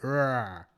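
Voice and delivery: RAH as a strained, comic "urr-ah"; botched hard R. Shown as a demonstration. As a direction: overdo the R in "Rah" until it collapses into a vowel. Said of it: "but it comes out like".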